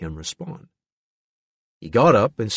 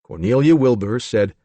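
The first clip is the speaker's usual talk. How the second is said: ordinary delivery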